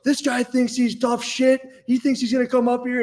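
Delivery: very high-pitched imitation of a raging drunk; mock screaming. Drunk student ranting about him in a dorm, mock screaming. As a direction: rant to onlookers in a strained high yell. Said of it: "he was just screaming at me".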